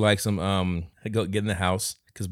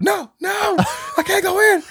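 high, loud panicked shout; flipping out; 7 dB louder than usual. Retold or imitated. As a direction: lose it in full panic. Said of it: "starts flipping out".

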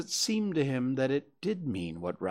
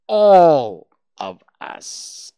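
very drawn out, loud first word; slow, emphatic, deliberate delivery; slow and careful; 3 semitones higher, 16 dB louder, much slower than usual. A narrator's reading. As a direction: stretch each word with care. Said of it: "very slowly and carefully".

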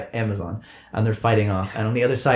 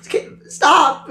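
high-pitched character voice; 4 dB louder, slower than usual. Acted. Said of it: "He's like".

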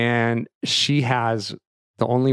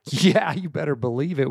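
higher-pitched, emphatic yes; eager agreement; 5 semitones higher than usual. Retold or imitated. Dad tells daughter they can go up close, eager agreement. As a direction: agree with gusto, pitch lifted.

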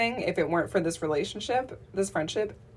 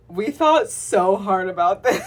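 laughing; 6 dB louder, slower than usual. Retold or imitated. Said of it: "We're gonna listen back on this and be like".

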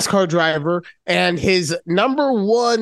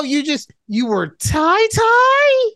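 much higher and slow, mock disbelief; playful incredulous tease, slow; 11 semitones higher, slower than usual. Real, not acted. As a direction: drag out the teasing question. Teasing disbelief at her excuse.

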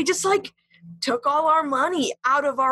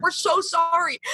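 higher-pitched retold plea, only lightly tearful; mock sobbing apology; 7 semitones higher than usual. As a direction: imitate a tearful apology without really crying. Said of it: "We were, like, sobbing".

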